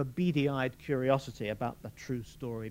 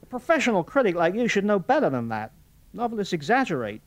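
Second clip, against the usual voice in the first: louder, higher, fast blustering man; blustering Morris; 8 semitones higher, 8 dB louder than usual. A narrator's reading. Morris fends off Fulvia in loud, fast bluster. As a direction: brush her off with quick bluster.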